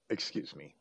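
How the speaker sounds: ordinary delivery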